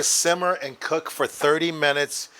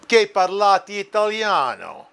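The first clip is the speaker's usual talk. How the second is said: speaking Italian; 5 semitones higher, 5 dB louder than usual. Retold or imitated. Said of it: "they were speaking true Italian".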